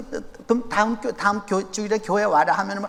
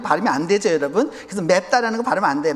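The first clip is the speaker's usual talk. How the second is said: ordinary delivery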